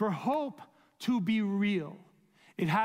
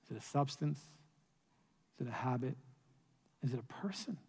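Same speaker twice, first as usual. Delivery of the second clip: dropping to a near whisper, softer line by line; hushed questions; 4 semitones lower, 8 dB quieter, faster than usual. Acted. Preacher hushing the congregation with searching questions. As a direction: ask quietly, drawing the listener inward.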